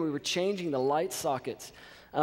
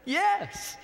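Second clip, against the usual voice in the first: very high-pitched exasperated yes; mock enthusiasm. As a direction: give in with a high mock cheer. Acting his giving-in answer to landlord.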